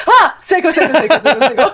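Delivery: startled yelp, then quick repeated name; high, hurried mock-panicked chant; rapid self-correction. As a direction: catch yourself and rattle off the name. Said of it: "I had to say Seiko five times".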